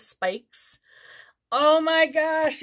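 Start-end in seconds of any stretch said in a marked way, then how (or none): 1.5-2.6 higher, louder oh my gosh; drawn-out excited oh my gosh; excited squeal. Real, not acted. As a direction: squeal with delight over the lashes. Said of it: "I'm so excited".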